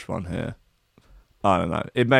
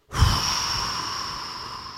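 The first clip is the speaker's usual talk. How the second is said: long, unvoiced sigh; drawn-out wordless breath; long exhale; 7 dB quieter than usual. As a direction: let the air slowly drain out. Reacting to a harsh put-down.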